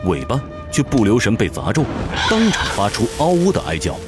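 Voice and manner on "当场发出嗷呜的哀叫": ordinary talk, nothing marked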